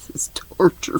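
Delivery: sleepy whispered complaint. Real, not acted. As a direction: breathe it out, half asleep. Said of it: "I keep drifting off".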